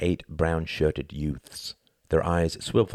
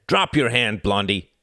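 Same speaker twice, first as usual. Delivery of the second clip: loud, higher, snarling command; 6 semitones higher, 6 dB louder than usual. A narrator's reading. The bald man ordering Klaus, loud snarling command. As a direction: bark it at the boy. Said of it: "snarled the bald man".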